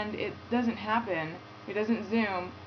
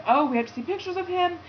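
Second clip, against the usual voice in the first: excited; 11 semitones higher, 4 dB louder, faster than usual. Retold or imitated. Friend eager to see boyfriend photos.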